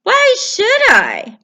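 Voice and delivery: cheeky turtle; stubborn turtle. Acted. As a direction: drawl it back defiantly.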